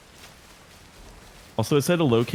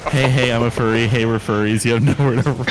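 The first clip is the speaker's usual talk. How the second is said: imitating the taunt; 6 dB louder than usual. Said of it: "That's so good".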